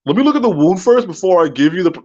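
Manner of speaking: high, put-on pharmacist voice; pharmacist impression. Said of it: "So the guy's like".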